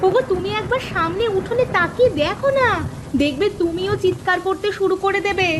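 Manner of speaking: high, breathless-with-news insistence; excited urging. Acted. Wife pointing husband to the flooded yard, excited urging. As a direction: urge him to look, pitched right up.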